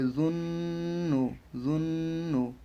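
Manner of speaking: "জুন্নু" with a long hummed first n; slow nasal hold in "জুন্নু"; ghunnah. Shown as a demonstration. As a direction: hold the n, then a normal "নু" in "জুন্নু". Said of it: "প্রথম নুনটাকে গুণনা করব, পরেরটাকে সাধারণভাবে".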